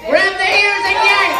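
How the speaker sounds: high, loud shouted command; insistent shout. Acted. Comic telling a volunteer to yank his ears, insistent shout. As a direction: yell the instruction at full pitch. Repeats the instruction, escalating.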